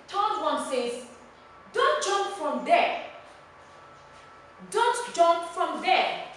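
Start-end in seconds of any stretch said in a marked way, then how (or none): 1.7-3.0 high, loud warning order; raised, forceful demo command. Shown as a demonstration. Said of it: "It is a command". 4.7-6.2 high, loud, slow order; emphatic drawn-out demo command. Shown as a demonstration. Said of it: "It is a command".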